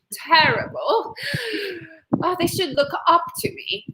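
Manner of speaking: mock British snob looking down on the neighbors; fake British accent. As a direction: put on a superior, hammed-up British voice. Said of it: "fake British accent".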